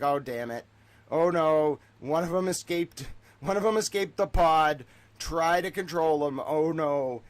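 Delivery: exaggerated "oh no" dismay; mock panic. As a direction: act out captors raising a comic alarm. Acts out the panicking captors.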